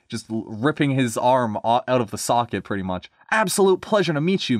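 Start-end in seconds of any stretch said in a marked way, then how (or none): 3.3-4.6 higher, delighted greeting; beaming. Acted. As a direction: greet a guest with keen pleasure. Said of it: "he beamed".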